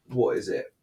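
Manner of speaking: normal talking voice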